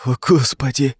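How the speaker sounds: high, frightened exclamation; exclaiming. A narrator's reading. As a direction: cry out in alarm. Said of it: "воскликнул Жером".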